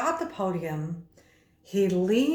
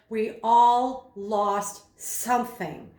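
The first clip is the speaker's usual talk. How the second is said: slowed right down, each word given weight; slow and rhythmic; much slower than usual. Shown as a demonstration. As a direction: let each word land slowly. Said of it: "when he slowed down and he literally accentuated".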